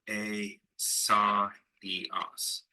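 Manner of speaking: "a, sa, e, os" sounded out syllable by syllable; vowels pronounced separately. Shown as a demonstration. Teacher sounding out Greek Isaiah, "a, sa, e, os" in separate syllables. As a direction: separate "sa" from "e" with a small break. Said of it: "pronounce the vowels separately".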